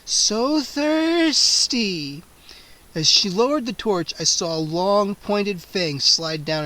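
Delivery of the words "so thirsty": slow, stretched, eerie vampire hiss. A narrator's reading. Scene: vampire Gwendolyn hisses at the trapped kids, slow eerie. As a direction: drag each word out, hungry. Vampire repeats her hunger.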